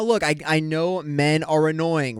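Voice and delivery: ordinary talk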